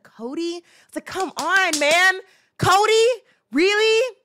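loud, high, pleading disbelief; exasperated disbelief. Retold or imitated. Fan shouting at Cody in disbelief. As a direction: shout his name in disbelief. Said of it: "I was like".

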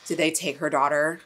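ordinary talk, nothing marked

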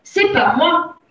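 high, loud protest of innocence; crying out. A narrator's reading. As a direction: cry out as an accused boy. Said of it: "s'écria le gamin". (s'écrier pour protester)

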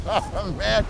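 high, helpless laughter through the words; laughing in disbelief. Real, not acted. Telling an interview blackout story on himself, laughing in disbelief. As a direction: crack up while telling it, pitch shooting up.

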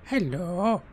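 villain voice. Acted. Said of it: "Yours is".

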